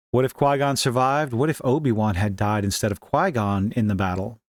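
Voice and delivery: normal talking voice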